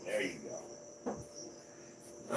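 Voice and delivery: slow, breathy soothing whisper; very slow whispered reassurance. Real, not acted. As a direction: settle it down in a whisper. Soothing the snake softly.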